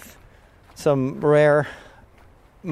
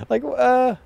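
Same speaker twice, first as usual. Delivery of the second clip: laughing; 11 semitones higher, 4 dB louder than usual. Real, not acted. Lost train of thought, laughing.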